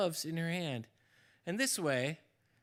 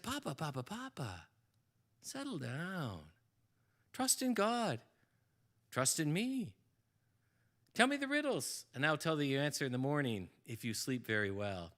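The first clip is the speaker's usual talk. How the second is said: soothing daughter; 4 semitones lower, 5 dB quieter than usual. Acted. Daughter Rachel calming her frantic father, soft and soothing. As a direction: settle him down gently.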